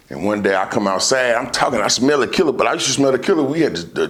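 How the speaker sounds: ordinary delivery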